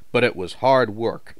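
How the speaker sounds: usual speaking voice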